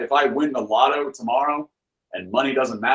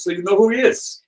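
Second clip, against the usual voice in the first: higher-pitched mock Russian questioning; Russian accent; 10 semitones higher than usual. Russian friend questions him about the bootlegger, high imitated Russian accent. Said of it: "that same Russian friend".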